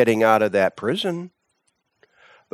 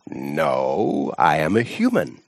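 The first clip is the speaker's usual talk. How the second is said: low calm Seton; 9 semitones lower, slower than usual. A narrator's reading. Seton answers the tattooed man.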